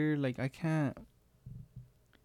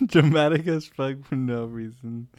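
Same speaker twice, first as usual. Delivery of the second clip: laughing loud and slow; laughing loudly; 7 dB louder, slower than usual. Real, not acted. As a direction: say it through a big laugh.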